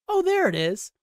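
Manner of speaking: high, chirpy Chit exclaiming; Chit's discovery. Retold or imitated. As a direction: squeal it out like a pleased fool. Chit finds the purse.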